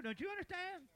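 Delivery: ordinary delivery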